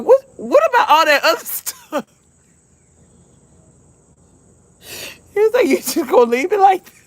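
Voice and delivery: high retelling broken up by laughter; really tickled. Real, not acted. Mother quoting her son's question, helplessly tickled. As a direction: barely get the quote out for laughing. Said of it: "I was so tickled".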